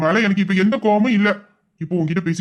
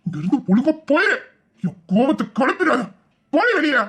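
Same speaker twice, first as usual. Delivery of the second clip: higher, sharp command to leave; angry order out; 4 semitones higher than usual. Acted. Husband ordering his wife out of the room, angry command. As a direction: throw her out of the room.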